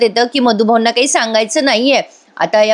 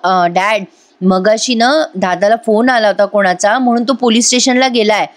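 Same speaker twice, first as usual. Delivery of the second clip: ordinary delivery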